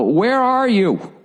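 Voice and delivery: high, very slow, strange-sounding arena guy; arena guy on the phone. Retold or imitated. Arena guy asking the lost comedian where he is, mimicked slow and high. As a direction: imitate the arena guy drawing out the question. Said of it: "he's going".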